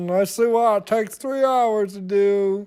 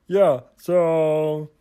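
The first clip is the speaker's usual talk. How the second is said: ordinary talk, nothing marked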